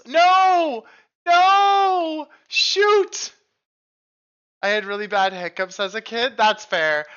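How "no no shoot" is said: high, loud dismay; dismayed cries. Real, not acted. Streamer yelping as the game goes wrong, dismayed. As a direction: yelp at a bad moment.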